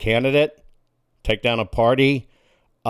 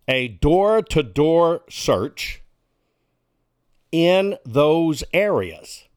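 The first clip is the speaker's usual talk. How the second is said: slow emphatic; 2 semitones higher, much slower than usual. Real, not acted. Slowed for emphasis.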